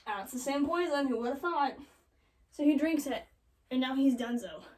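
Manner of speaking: usual speaking voice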